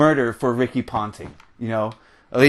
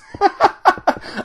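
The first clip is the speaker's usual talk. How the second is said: high laugh. Real, not acted. Laughing at own rant.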